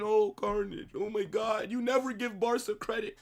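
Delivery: high, whining mock complaint; whiny fan imitation. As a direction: whine it like an upset fan. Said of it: "Don't be in the comment section".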